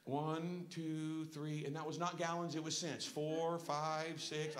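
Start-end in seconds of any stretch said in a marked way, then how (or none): 0.0-1.6 drawn-out, lower counting; sluggish, evenly spaced numbers; slow pump count. Acted. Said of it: "that pump was going like this". 3.1-4.6 low, plodding counting; sluggish, evenly spaced numbers; slow pump count. Acted. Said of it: "that pump was going like this".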